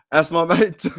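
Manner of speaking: words squeezed out through laughter; laughing. Real, not acted. Streamer remembering his late mate's teasing, laughing. Still cracking up about his mate.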